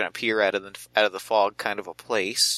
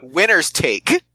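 assertive slogan; 5 semitones higher, 4 dB louder, slower than usual. Acted. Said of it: "try to say that with some assertiveness".

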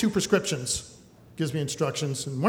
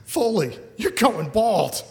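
laughing tease; 5 semitones higher, slower than usual. Retold or imitated. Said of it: "he starts laughing".

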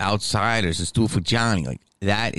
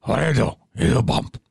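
Pacino impression; 6 semitones higher, faster than usual. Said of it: "doing them right".

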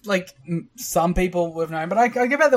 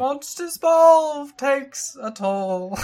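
mock rhyming promo; 9 semitones higher, much slower than usual. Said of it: "the rhyming poem".

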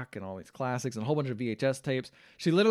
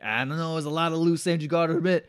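put-on Bronx accent, pushed louder and higher; Tony; 4 semitones higher, 4 dB louder than usual. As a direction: needle her like a Bronx wise guy. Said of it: "he's totally from, like, the Bronx".

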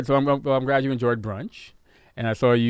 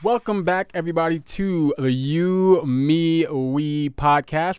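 bright, upbeat show opener; podcast host intro; 4 semitones higher, 4 dB louder, slower than usual. Acted. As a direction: welcome listeners with energy.

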